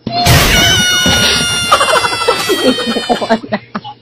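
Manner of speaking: a high shriek of laughter breaks out; cracking up at the skit; high laughing shriek. Real, not acted. Laughing at the fake quarrel.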